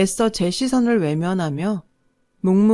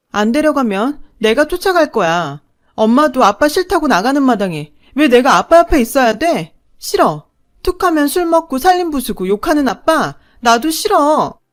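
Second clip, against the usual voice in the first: loud, higher, slowing at the end; tearful defiance; 6 semitones higher, 6 dB louder than usual. A narrator's reading. Daughter refusing to stay with her drunken father, loud defiance. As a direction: shout back your refusal. Child shouting refusal at mother.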